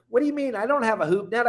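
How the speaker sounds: a retold what-are-you-talking-about; mildly acted shock at the news; incredulous. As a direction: pitch jumps up in disbelief, then settles a little. Disbelief at the diver's claim.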